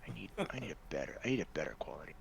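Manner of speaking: hushed whisper under the breath. Real, not acted. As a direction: whisper it to yourself. Whispered aside about bad quality.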